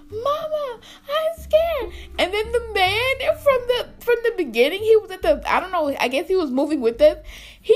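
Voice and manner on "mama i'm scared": high, exaggerated little wail; mock scared wail. Storyteller mimics terrified high school friend, mock scared wail. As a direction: imitate a frightened friend pleading for Mama. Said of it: "this girl was like".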